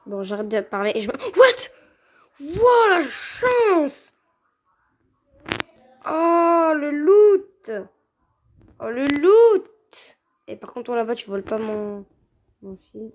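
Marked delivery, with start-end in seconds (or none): 1.1-4.1 shrieking what, wow with surprise; surprised shout. Real, not acted. Sudden lucky loot find. 6.0-10.2 loud, drawn-out cry of delight; excited shout. Real, not acted. Excited over loot.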